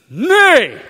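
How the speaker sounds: loud, sharp no; firm no. Acted. Preacher loudly refusing his body's cravings. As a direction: shut the craving down hard.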